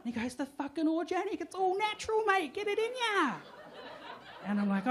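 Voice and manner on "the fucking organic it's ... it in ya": much higher Darren; hammed-up high bloke. Darren pushing the organic sauce on him.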